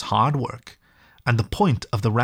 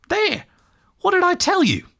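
much higher, crowing exclamation; high, triumphant cry. A narrator's reading. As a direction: crow over being right. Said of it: "exclaimed the rat".

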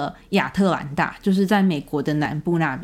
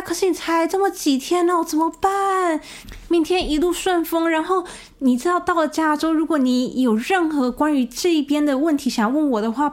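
near tears; 8 semitones higher than usual. Retold or imitated. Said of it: "他快要哭出来的表情跟我讲说".

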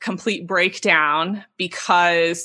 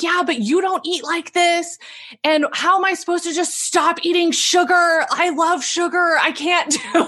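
whiny panic; 9 semitones higher than usual. Retold or imitated. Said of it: "And I'm like".

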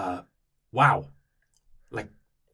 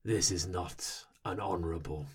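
low, slow, solemn warrior voice; a hint of a dour Viking chief, lower; grave warrior; 5 semitones lower, 5 dB quieter than usual. Kith warrior gravely declaring dishonour to the party, low and slow. NPC grave speech in accent.

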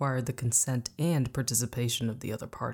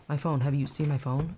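plain reading voice

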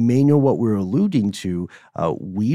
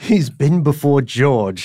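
hammed-up; 2 semitones higher, 4 dB louder, slower than usual. Relishing a silly entry.

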